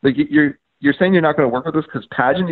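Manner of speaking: high, questioning disbelief; retold disbelief, lifting into questions; incredulous. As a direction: imitate a baffled staffer double-checking. Retold staffer questions the objection.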